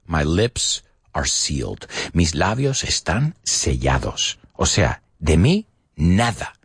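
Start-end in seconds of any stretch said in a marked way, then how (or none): 0.1-4.4 slow model phrase. Shown as a demonstration. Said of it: "But we do say".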